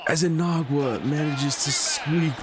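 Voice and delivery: high, slow, straining with hope; willing the quarterback through the line; a touch of nervous excitement; tense excitement. Real, not acted. Quarterback squeaks into end zone.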